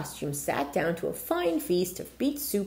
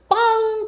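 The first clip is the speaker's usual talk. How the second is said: clock chime; 8 dB louder than usual. Acted. Clock chiming.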